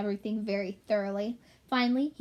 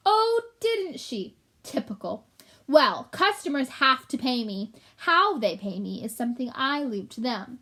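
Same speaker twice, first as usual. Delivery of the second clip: mocking rat voice; 2 semitones higher, 4 dB louder than usual. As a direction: sneer like a greedy rat. Gilbert mocking.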